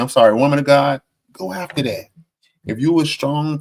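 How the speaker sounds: ordinary talk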